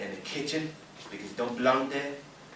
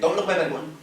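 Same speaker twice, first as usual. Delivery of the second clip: sudden loud warning; 8 semitones higher, 11 dB louder than usual. Acted. Sudden outburst at the Frenchman.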